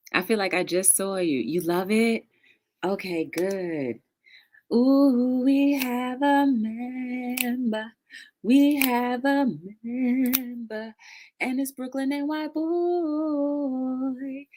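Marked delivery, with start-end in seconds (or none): 4.7-14.5 excited sing-song announcement. Real, not acted. Announcing a new channel member.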